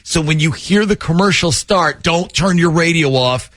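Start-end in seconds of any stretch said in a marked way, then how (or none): none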